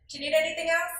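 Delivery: really beam as you ask; with a smile. Shown as a demonstration. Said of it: "You can say that with a smile".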